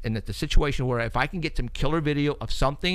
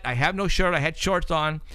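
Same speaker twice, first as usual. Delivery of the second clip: ordinary delivery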